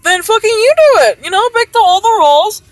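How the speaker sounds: high, loud, exasperated retold outburst; furious retort. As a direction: throw the job back at them, yelling.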